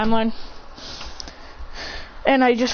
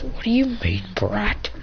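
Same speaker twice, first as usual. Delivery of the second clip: low sneering villain; 3 dB quieter, slower than usual. Acted. Villain answers hero.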